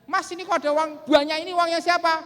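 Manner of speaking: high, loud acted wifely cry; acted wife's startled yell. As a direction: shout in surprise to your husband. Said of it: "terus istrinya teriak".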